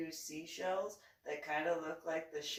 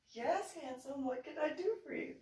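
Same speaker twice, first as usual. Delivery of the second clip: lightly voiced doting mom, pitched high; high lilting reply to a beloved son; doting mother; 7 semitones higher than usual. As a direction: re-enact a mom indulging her boy, high. Doting mother answers her son.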